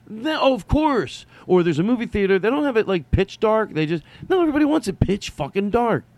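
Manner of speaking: getting fired up about dark theaters; excited rant. Real, not acted. Griping to viewers about theaters, high and excited.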